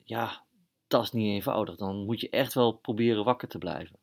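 ordinary delivery, nothing marked

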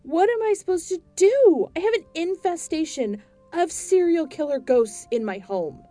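loud, high exasperated cry; fed-up homeowner shouting. Acted. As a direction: throw up your hands, shouting. Despairing shout.

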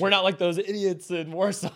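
high mock-proud local voice; lightly voiced boastful Czech guide; smug Prague local. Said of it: "That's what they'll say".

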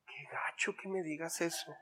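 a wounded little aside, breathed; hurt whisper. Acted. Hurt reaction to criticism voiced. (susurro dolido, medio actuado)

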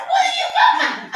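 daughter's voice. Retold or imitated. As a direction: shoot back like a puzzled daughter. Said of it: "She said".

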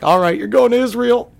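mock the mom laying down the law; stern mom. Mom to her pot-selling son, a high, loud mock verdict. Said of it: "she was like".

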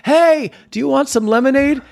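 put-on little girl calling out. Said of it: "She goes".

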